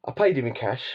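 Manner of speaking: normal talking voice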